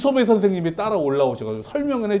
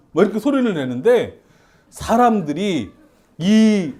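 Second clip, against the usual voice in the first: normal talking voice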